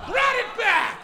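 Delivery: loud, high triumphant yell; triumphant shout. Real, not acted. To the crowd after a callback, a loud high triumphant yell. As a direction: crow it out.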